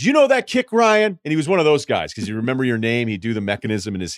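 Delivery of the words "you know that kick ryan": put-on much higher, louder old coach voice; Lou Holtz impression. To a young staffer, old coach asks about the kick in a high, strained voice. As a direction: pitch it up like an old football coach.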